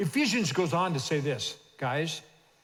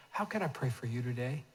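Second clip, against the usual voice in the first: soft asking; 4 semitones lower, 7 dB quieter than usual. Shown as a demonstration. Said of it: "just ask this question".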